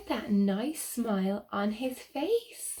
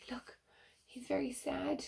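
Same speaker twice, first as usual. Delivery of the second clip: soft unvoiced whisper, much softer; sympathetic whisper; 5 semitones higher, 10 dB quieter than usual. Real, not acted. Pointing at the picture, whispering sadly to children.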